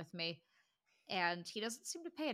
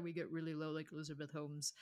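Elizabeth Holmes impression; 4 semitones lower, 6 dB quieter than usual. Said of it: "was a good impression".

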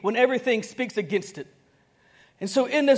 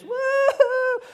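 mock mourner wail; 4 dB louder than usual. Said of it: "professional mourners, to come and just".

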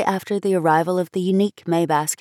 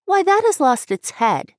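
much higher, louder scorn; scornful girl; 12 semitones higher, 4 dB louder than usual. A narrator's reading. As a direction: sneer at the headless angel.

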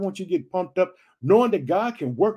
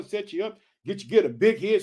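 ordinary delivery

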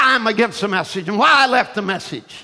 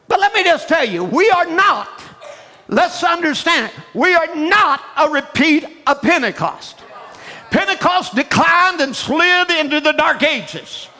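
preaching; 9 semitones higher than usual. Acted. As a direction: preach it out to the crowd. Preaching at full force.

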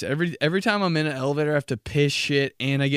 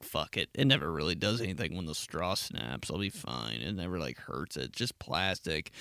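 low dismissive mumble; 7 semitones lower, 6 dB quieter than usual. Retold or imitated. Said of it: "where you're like".

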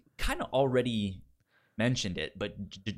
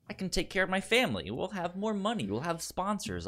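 higher, faster inner voice of the hero; Speed weighing options; 9 semitones higher, faster than usual. Retold or imitated. Host voicing Speed's dilemma over going corporate, higher and quick. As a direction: think it through aloud as Speed, pitched up.

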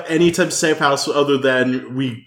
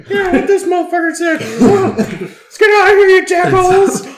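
rallying shout; 14 semitones higher than usual. Acted. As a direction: call it out high and bold. Character rallying the group.

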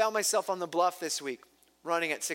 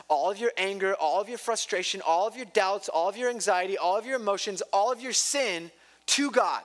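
ordinary delivery

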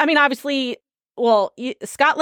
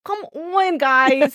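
a higher, playful "come on, guys"; self-mocking catchphrase, pitched up; own catchphrase; 4 semitones higher, much slower than usual. Shown as a demonstration. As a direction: ham up your own pet phrase. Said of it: "I actually hate that I do it".

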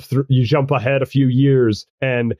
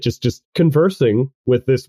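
ordinary talk

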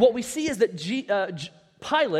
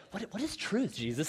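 sincere curiosity; 7 dB quieter than usual. Acted. Imagined Pilate coming to Jesus for answers, sincerely curious. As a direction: ask as if truly wanting to learn. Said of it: "this question is not asked with sincerity".

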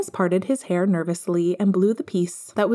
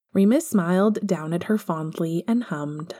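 plain reading voice

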